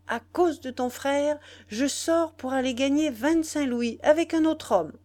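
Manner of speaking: high, loud, scornful tirade; venting her annoyance. A narrator's reading. Nana rails at the boy about his brother, vexed. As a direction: unload the morning's troubles on the listener. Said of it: "heureuse de se soulager sur lui de ses embêtements".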